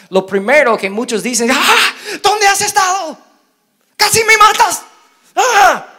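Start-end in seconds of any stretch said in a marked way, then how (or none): 1.5-4.8 high, loud, half-laughing scold; frantic parent yelling. Retold or imitated. Parent shouting at the child found after an hour, high frantic yell. 5.3-5.9 mock exasperated cry.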